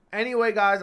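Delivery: sudden loud jump from a hiccup. Real, not acted. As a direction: hiccup bursts the words. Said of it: "I can't get rid of these hiccups".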